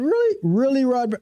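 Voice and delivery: high, disbelieving Really; playfully shocked at the chat; mock outrage at a rude viewer; incredulous. Real, not acted.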